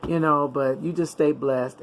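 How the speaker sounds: ordinary delivery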